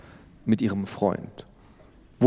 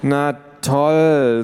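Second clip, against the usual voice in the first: loud, higher sarcastic groan; 5 semitones higher, 7 dB louder than usual. Acted. Teen sister as her little sister walks in, loud sarcasm. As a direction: drip with annoyance. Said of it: "sagte Luisa".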